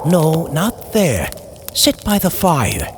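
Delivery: ordinary delivery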